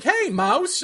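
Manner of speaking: startled hail to a mouse; calling to a mouse. Retold or imitated.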